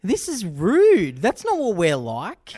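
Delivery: offended 1970s viewer. Retold or imitated. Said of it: "and then being like".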